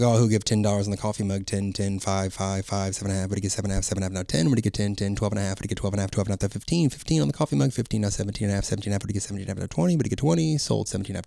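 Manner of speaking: rapid auction chant; auctioneer. Shown as a demonstration. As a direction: rattle off bids like an auctioneer. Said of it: "You wanna hear it?".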